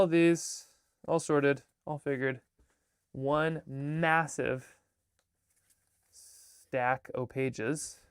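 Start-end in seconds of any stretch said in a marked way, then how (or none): 3.1-8.0 very slow, stretched words; slow mock-grand reveal of the pile; drawn out. Real, not acted. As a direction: savour each word slowly. Shows pile of pages.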